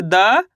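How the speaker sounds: द drawn out as "daa", rising at the end; द held long as one separate syllable; letter demonstration. Shown as a demonstration. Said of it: "इस तरीके से".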